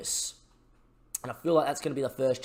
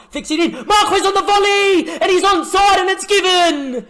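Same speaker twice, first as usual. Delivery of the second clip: much higher, much louder, laughing goal shout; goal celebration scream; 12 dB louder, slower than usual. Real, not acted. Marquez volleys in the winner and the manager screams with joy. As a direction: explode with joy as the volley goes in. Late goal scored in extra time.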